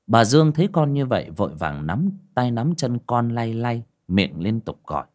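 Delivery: ordinary delivery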